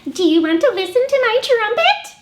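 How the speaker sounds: a bossy eager little pig, bursting; Piggy. Acted. Librarian voicing Piggy showing off a trumpet to Gerald.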